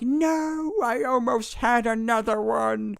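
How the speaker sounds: put-on high, whining boy; whiny kid. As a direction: wail it like a disappointed kid. Wifi cuts off kid.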